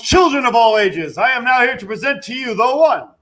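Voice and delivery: booming ring announcer intro. Pretend. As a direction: build hype like a ring announcer.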